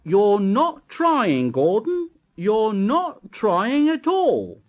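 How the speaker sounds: slow, loud, stern telling-off; severe scolding. A narrator's reading. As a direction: scold him hard and slow. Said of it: "talking to him severely".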